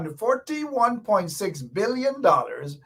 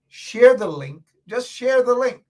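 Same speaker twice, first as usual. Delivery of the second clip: loud, insistent call to listeners; loud urging; 6 semitones higher, 5 dB louder than usual. Real, not acted. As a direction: urge "Share the link" out loud. Urging listeners, then dropping to whisper.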